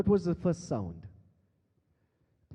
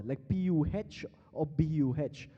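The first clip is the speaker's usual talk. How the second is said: ordinary talk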